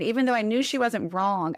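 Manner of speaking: usual speaking voice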